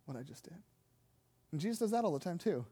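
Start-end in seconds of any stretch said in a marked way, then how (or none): 0.0-0.6 low, very soft acted order; hushed warning. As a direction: drop to a low hush, as if confiding a secret. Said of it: "He says it really sternly".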